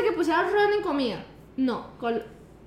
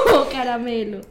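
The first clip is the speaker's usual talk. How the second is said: loud words through laughter; laughing objection; 3 semitones lower, 5 dB louder, slower than usual. Real, not acted. Player rejects colorido for caramelo, laughing objection. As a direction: object while still laughing.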